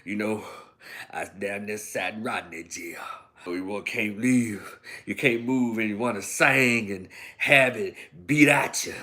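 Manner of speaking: low, hushed, half-whispered and worn out; a beaten-down woman speaking low and tired; lightly voiced Sofia from The Color Purple, low and hushed; Sofia's weary voice. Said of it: "finally opened her eye up and started talking".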